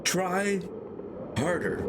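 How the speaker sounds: high, urging shout; urgent call. Acted. Aeronaut urges the boy from the balloon, high and urgent. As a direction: push him from above, high.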